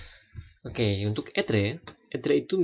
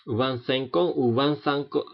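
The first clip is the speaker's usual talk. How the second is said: vingt-cinq ans said slowly, twice; slow repeated French model; slow French demo; 2 semitones higher, slower than usual. Shown as a demonstration. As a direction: repeat the phrase carefully.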